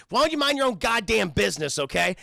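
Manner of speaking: acted testy outburst; angry retort. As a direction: tell someone off, defensive. User snaps at nosy girl.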